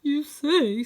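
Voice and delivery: put-on a big lion whining like a child; whimper. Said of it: "with a whimper".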